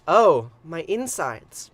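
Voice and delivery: slow, loud drawn-out moan of pain; moaning sigh. Acted. Goddess in labor, a slow loud moaning cry. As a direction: let the goddess groan it out slowly.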